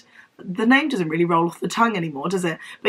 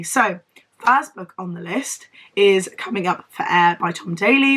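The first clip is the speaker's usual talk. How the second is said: ordinary talk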